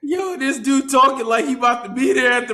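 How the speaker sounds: loud, high, cracking up; laughing tease. Real, not acted. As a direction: call him out through laughter. Teasing co-host amid group laughter.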